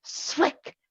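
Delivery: breathy whispered sound word; teasing whisper of a sound effect. A narrator's reading. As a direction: whisper it right at her. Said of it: "and whispered".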